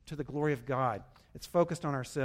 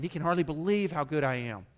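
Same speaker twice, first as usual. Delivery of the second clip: ordinary talk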